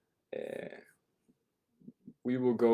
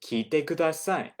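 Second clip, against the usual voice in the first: brisk, higher teacher voice giving an instruction; teacher telling the class to listen to the CD; teacher's classroom command; 6 semitones higher, 4 dB louder than usual. Shown as a demonstration. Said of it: "classroom commands".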